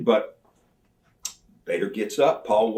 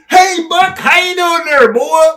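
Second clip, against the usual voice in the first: much higher and louder, hammed-up buddy voice; Vader's friendly greeting; 9 dB louder than usual. Vader pulling up to Buck and Jimmy, high loud hammed-up cheer. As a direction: greet him like a long-lost friend.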